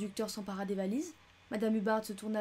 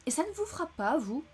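high, pointed challenge; stern retort; 6 semitones higher, 3 dB louder than usual. Acted. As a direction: throw the question back at him. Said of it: "jetant un regard sévère".

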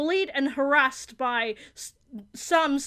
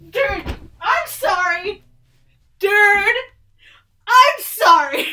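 slow, loud, higher pleading cries; excited yelling; 5 semitones higher, 8 dB louder, slower than usual. Real, not acted. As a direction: yell it, can't calm down. Keeps apologizing mid-excitement.

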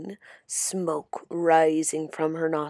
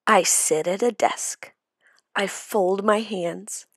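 usual speaking voice

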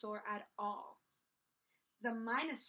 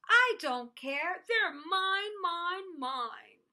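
put-on loud, high, defiant childlike yell; 5 semitones higher, 8 dB louder, much slower than usual. A narrator's reading. Minosaur refusing to share toys, yelling. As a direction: yell stubbornly, falling on the last word. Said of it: "But the Minosaur yelled".

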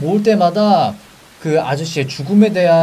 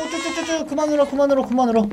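put-on high, soft cooing at a baby; mock baby soothing; 7 semitones higher, 4 dB quieter, faster than usual. Gamer hushes the in-game baby, soft mock cooing. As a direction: coo to hush a crying baby.

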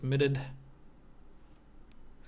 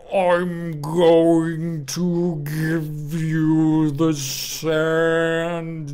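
slowed distorted voice; 3 semitones higher, 9 dB louder, much slower than usual. Pretend. Slow distorted voice line.

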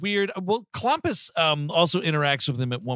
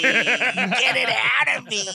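high, loud, drawn-out mock scream; imitated yelling; 9 semitones higher, 5 dB louder, much slower than usual. Podcaster imitates dying man with gold in belly, long mock yell. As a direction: stretch the yell out, high and louder. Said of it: "he's just yelling".

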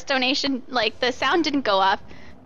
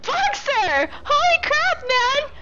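excited shriek; 13 semitones higher, slower than usual. Real, not acted. Shocked reaction to donation.

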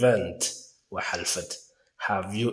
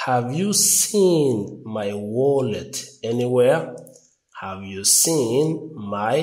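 slow model question; 2 semitones higher, much slower than usual. Shown as a demonstration. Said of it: "slow model question for learners".

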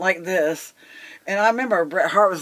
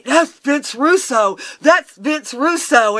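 high, loud, slow disbelief; drawn-out, loud awe; shocked warning; 10 semitones higher, 8 dB louder, much slower than usual. Retold or imitated. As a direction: imitate an aghast bystander stressing who that is. Retold shocked warning from a colleague.

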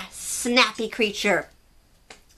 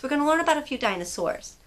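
ordinary delivery, nothing marked

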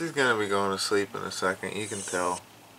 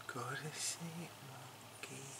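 light soft voice; 16 dB quieter, slower than usual. Real, not acted. Telling Mookie the cat to sleep, hushed and slow. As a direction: lull the cat to sleep softly. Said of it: "in that light voice".

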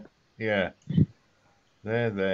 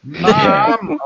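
calling out; 8 semitones higher, 8 dB louder than usual. Pretend. Acted kid calling mom.

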